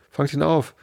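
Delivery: the rabbit shouting orders; rabbit calling. Acted. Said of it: "die Stimme des Kaninchens allein".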